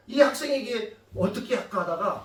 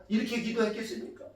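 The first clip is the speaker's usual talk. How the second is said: ordinary delivery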